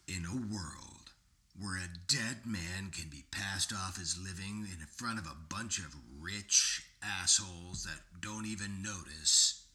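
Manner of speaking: deep whispered in a world voice; movie trailer voice. Pretend.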